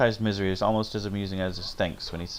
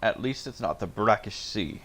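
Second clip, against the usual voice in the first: ordinary talk, nothing marked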